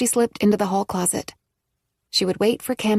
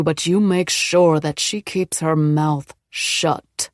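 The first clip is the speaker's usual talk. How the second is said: low angry voice; 3 dB louder than usual. A narrator's reading. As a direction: threaten her coldly. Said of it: "one low, angry voice".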